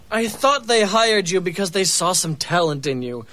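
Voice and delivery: normal talking voice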